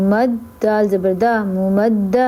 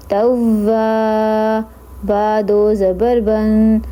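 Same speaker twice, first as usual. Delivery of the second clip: ordinary delivery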